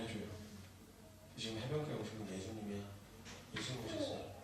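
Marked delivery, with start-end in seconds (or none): 1.4-4.3 hushed telling. Acted. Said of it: "이렇게 얘기를 해줬어요".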